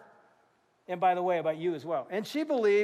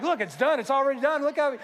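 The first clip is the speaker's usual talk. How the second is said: louder, laughing triumph; joyful; 4 semitones higher, 5 dB louder than usual. Retold or imitated. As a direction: show off with delight. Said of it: "I'm like".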